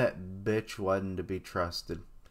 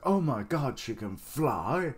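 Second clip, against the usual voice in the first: mock amazement; 5 semitones higher than usual. Reader mocks a character's reaction to flying, mock amazement. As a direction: imitate someone astonished by the wrong thing. Mocking a silly first reaction.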